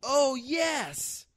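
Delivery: real delighted outburst, high-pitched; excited reaction. Real, not acted. Delighted reaction to karate story.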